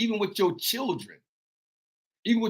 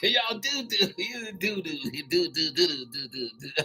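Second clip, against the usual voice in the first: laughing; 6 dB quieter than usual. Real, not acted. Laughing at his own joke.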